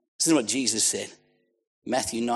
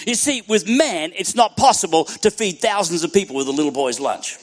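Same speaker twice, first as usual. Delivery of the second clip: raised, emphatic pulpit voice; impassioned preacher; preaching; 7 semitones higher, 8 dB louder than usual. Acted. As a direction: build it forcefully phrase by phrase.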